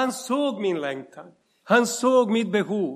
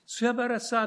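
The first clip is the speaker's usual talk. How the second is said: normal talking voice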